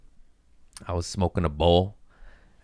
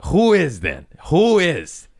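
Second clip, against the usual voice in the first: loud, high-pitched challenge; imitated angry bouncer shout; confrontational bouncer; 10 semitones higher, 7 dB louder, slower than usual. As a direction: bellow it like a bouncer demanding an answer. Acted bouncer challenges him in smoky bathroom.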